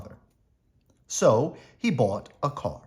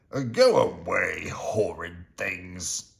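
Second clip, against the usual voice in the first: put-on grumpy old mouse shout; 4 semitones lower, slower than usual. A narrator's reading. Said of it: "he would shout".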